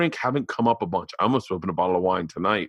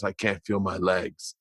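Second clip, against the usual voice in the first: soft, low, slow delivery; wounded man; 4 semitones lower, 7 dB quieter, slower than usual. Retold or imitated. Retelling a wounded film character's line, weak and quiet. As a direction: let it out weakly, slowly.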